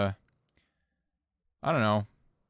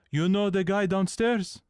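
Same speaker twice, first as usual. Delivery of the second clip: a lightly voiced, somewhat higher mock guard; imitating the security guard; 6 semitones higher than usual. Mimicking the roof security guard warning her, lightly voiced. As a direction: put on the guard's ominous tone. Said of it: "'Cause that's what he sounded like".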